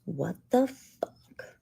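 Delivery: slow breathy whisper of disbelief; hushed, slow what-the-fuck; stunned whisper. Real, not acted. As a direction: whisper it, slowly, shocked. Stunned reaction to speech.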